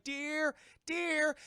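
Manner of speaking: searching all around and calling for her friend. Retold or imitated. Tulip searching for deer.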